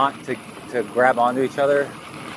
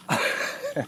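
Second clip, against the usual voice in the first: high breathy laugh; 9 dB quieter than usual. Real, not acted. Laughs at own hacksaw question.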